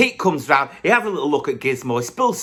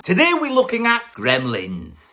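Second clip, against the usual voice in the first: higher, sing-song intro announcement; pitched-up host voice; high announcer; 9 semitones higher than usual. Acted.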